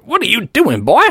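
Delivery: high-pitched accusing demand; angry demand. Acted. Doctor Paul grabbing the boy by the hand, high angry demand. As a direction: catch a thief red-handed.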